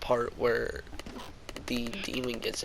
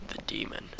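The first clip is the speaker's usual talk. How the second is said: hushed, much softer than the narration; whispered; 9 dB quieter than usual. A narrator's reading. Man warns Henry about the demon, whispered. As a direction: drop to a near-whisper. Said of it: "looked him in the eyes and whispered".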